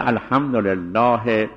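الحمدلله ending on an audible he; pause on the vowel. Shown as a demonstration. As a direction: sound the final e of الحمدلله, then stop. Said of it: "این وقف به حرکت".

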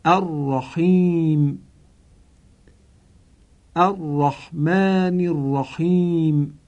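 slow word-by-word model with Arabic ḥ in الرحیم and بحمده; deliberate, drawn-out recitation of each word; Arabic sounds shown clearly, slowly; careful Quranic pronunciation. Shown as a demonstration. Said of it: "به این کلمات ... عنایت بفرمایید".

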